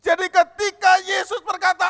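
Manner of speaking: shouting the sermon at the top of the voice; preaching at full force. Acted. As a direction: preach it out high and loud to the congregation. (berkhotbah dengan berteriak penuh semangat)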